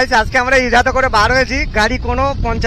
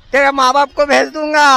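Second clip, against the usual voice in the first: loud boast; 5 semitones higher, 5 dB louder than usual. Real, not acted. Boasting to crowd on road.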